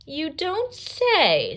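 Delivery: high, slow, drawn-out wolf voice; sly wolf. Acted. The wolf, fooling Red, in a sly drawn-out voice. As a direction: stretch it out like a scheming wolf. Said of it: "said the wolf".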